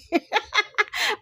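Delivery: a burst of high laughter that spills over; emotional laugh. Real, not acted. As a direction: laugh helplessly, overcome. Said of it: "I'm getting emotional".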